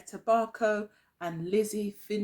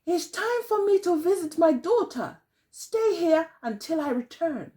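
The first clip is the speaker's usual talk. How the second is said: high, lightly voiced old woman; old woman's voice; 7 semitones higher than usual. Old woman telling her dogs, lightly voiced in a high voice. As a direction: speak up high as the old granny. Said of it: "she told her dogs".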